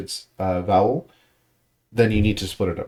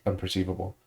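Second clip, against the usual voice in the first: ordinary talk, nothing marked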